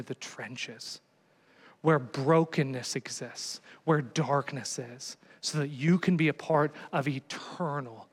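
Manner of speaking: slow, soft, low sermon close; hushed preaching. Acted. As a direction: draw them in with a hushed, slow appeal. Sermon climax.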